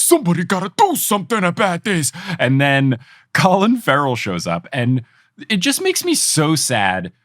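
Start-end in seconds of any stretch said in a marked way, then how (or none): 0.0-2.1 higher New York accent mimicry; New Yorker guy. Retelling the film's background extra, a light New York accent. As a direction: toss it off like a grumpy New Yorker. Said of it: "New Yorker guy says".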